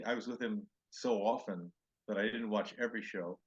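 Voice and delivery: ordinary talk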